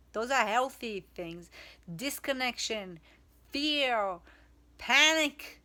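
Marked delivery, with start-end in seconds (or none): 2.0-5.6 building list. Real, not acted.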